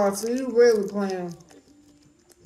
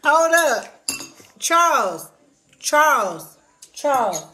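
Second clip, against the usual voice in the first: calling out; 4 semitones higher, 5 dB louder, slower than usual. Real, not acted. Calling the dog again.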